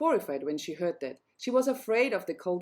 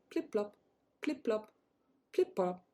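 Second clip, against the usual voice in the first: quiet, soft wet footsteps sound; softly voiced frog footsteps; soft plip-plop; 3 semitones higher, 5 dB quieter than usual. A narrator's reading. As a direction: tiptoe the sound softly up the steps. Frog steps on marble stairs.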